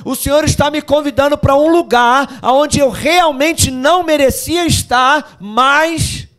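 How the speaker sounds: high, loud, fervent cry; full-force preaching; preaching shout. Real, not acted. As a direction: lift it up to the rafters.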